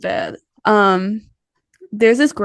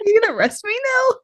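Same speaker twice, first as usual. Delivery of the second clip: mock defiant; 10 semitones higher, faster than usual. Mock challenge to cop grandma.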